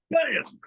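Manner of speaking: sharp high bam; mock impact sound. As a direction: punch out the hit like a sound effect. Mother's knuckles hit his forehead.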